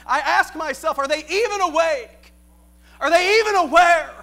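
fiery preacher building to a shout; preaching. Acted. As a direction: preach it with rising fire. Preaching at full force.

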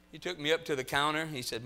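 ordinary talk, nothing marked

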